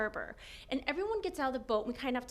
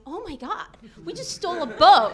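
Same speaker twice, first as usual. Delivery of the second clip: higher, louder dawning alarm; retold shock; 4 semitones higher, 15 dB louder than usual. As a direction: re-enact the horrified realization. Realizes they stole a boat.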